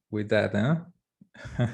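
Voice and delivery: ordinary delivery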